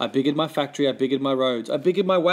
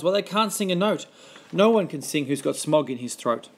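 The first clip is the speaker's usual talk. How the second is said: ordinary delivery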